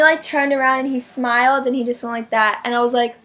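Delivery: ordinary delivery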